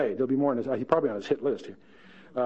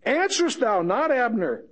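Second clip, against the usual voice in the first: higher, teasing call across the valley; David calling out; 7 semitones higher than usual. Acted. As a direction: taunt him from the hilltop. David calls down the hill.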